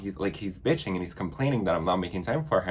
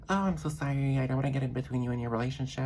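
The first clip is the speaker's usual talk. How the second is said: higher-pitched, hurried imitation of an apology; mock apologetic texter; 6 semitones higher, 3 dB quieter, faster than usual. Guy texting an apology for coming between a couple, mock apologetic. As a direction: voice someone else's sorry text, pitched up. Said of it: "the guy literally texted me".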